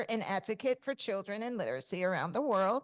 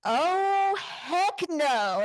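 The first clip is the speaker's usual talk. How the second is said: alarmed exclamation; 9 semitones higher, 5 dB louder, much slower than usual. Retold or imitated. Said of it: "I go".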